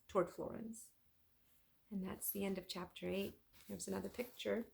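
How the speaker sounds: plain reading voice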